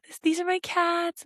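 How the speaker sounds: put-on high, kid-friendly show-and-tell voice; sweetly talking down to children. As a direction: pitch it up for little listeners. Showing pet photos to kids.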